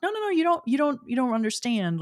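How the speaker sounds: high-pitched retold protest; mock-pleading, starting high; insistent protest. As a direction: brush the objection away with quick repeats. Retelling her insisting to her husband.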